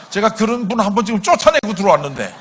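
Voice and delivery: loud, high, rushed exclamation; retold shock at full volume; startled dismay. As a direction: blurt it out, aghast at what you just found. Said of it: "제가 너무나 깜짝 놀라가지고".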